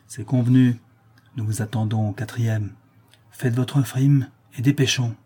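ordinary delivery

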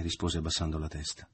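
plain reading voice

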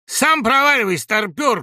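high, loud retort; insulting shout. A narrator's reading. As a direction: yell the insult straight back. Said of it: "крикнул Бобров". (дерзкий крик в ответ)

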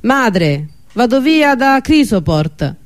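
mild narrated shout; calling out. A narrator's reading. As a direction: call the news upstairs to mother. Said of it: "gridò".

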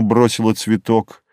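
ordinary delivery, nothing marked

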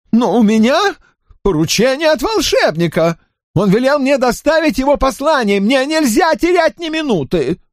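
high, shaky frightened cry; trembling exclamation. A narrator's reading. Drawlight insisting on the wizard's errand, trembling and high. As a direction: blurt it out in a panic. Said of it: "Дрожащим голосом он воскликнул".

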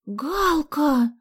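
high, loud appalled cry; horrified. A narrator's reading. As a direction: cry the name in dismay. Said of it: "ужаснулась Соня".

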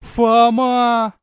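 loud high cry, joy and despair in one; joyful cry. A narrator's reading. Verona reaching Foma at last, loud overjoyed cry. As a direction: cry out everything you felt in one name. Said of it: "В этом крике было всё".

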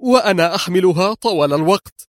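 high, indignant woman's voice; angry disbelief. A narrator's reading. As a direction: protest with rising outrage. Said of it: "كررت في غضب". (احتجاج غاضب بصوت مرتفع)

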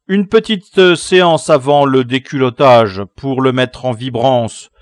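loud, high, gleefully bossy orders; commanding boy leader. Acted. Leader ordering the captive whipped, loud command. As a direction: shout orders to the gang as ringmaster.

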